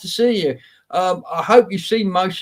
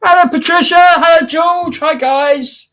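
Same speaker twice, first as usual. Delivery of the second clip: cheery shouted greeting; 13 semitones higher, 6 dB louder than usual. Real, not acted. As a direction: call out a big happy welcome to the chat. Greeting chat viewers on stream.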